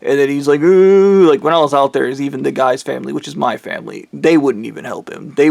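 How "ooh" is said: loud, high drawn-out "ooh"; imitated ooh. Speaker imitating his reaction, loud high ooh. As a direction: raise a long, loud mocking ooh.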